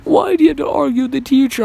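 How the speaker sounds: put-on high, loud whiny girl voice; mock crying girl. Crying classmate blaming him for arguing, whiny high voice. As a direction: whine it like a tearful little girl.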